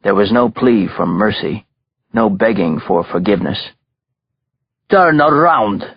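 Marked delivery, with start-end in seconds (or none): 4.9-5.9 put-on higher-pitched commanding Russian accent; ordered. A narrator's reading. Belova orders the kneeling-bound captive about, commanding. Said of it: "ordered Belova".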